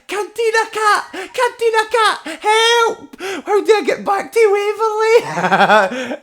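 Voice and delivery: high frantic shouting for help; desperate yell, voice cracking; panicked yell. Retold or imitated. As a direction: scream for your mate across the platform, half laughing.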